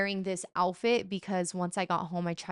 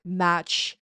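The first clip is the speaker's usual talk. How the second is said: ordinary talk, nothing marked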